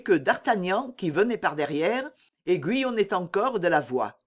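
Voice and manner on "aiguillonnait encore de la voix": plain reading voice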